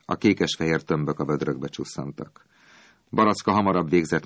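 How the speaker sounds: usual speaking voice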